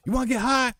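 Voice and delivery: high mock stoner voice; imitating Daryl. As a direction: offer it way up high, buddy-like. Daryl voiced offering weed.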